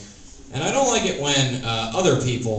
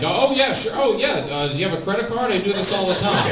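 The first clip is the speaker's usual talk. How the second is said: much higher, louder, over-eager gushing; eager helper; 8 semitones higher, 3 dB louder than usual. Retold or imitated. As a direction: gush like a too-willing accomplice. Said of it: "not be like".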